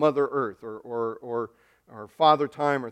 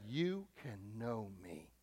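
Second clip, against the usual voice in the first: much softer and slow, almost intimate; tender invitation; 4 semitones lower, 17 dB quieter, slower than usual. Acted. God softly inviting his people, tender hush. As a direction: offer it gently like a quiet promise. Voicing God's gentle invitation.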